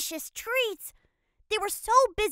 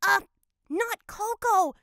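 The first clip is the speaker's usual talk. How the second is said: hurried. A narrator's reading. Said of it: "said the witch hurriedly".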